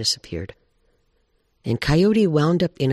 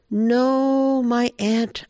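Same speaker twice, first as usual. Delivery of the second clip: high, drawn-out "no" from grandmother owl; owl's gentle refusal; 10 semitones higher, much slower than usual. Acted. Grandmother Owl turning down her aunt the bear, high and drawn out. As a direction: lift the voice high and hold it.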